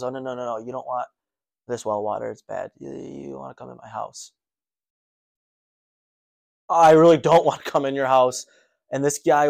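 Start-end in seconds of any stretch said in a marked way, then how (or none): 0.0-4.3 creepy voice. Retold or imitated. Creepy guy invites her inside. 6.7-8.5 nervous laugh. Retold or imitated.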